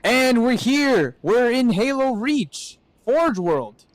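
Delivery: high, slow grand announcement; excited arrival announcement. Real, not acted. Announcing arrival in new world.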